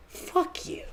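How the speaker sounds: high, unvoiced whisper; whispered jab. Real, not acted. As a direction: hiss it under your breath.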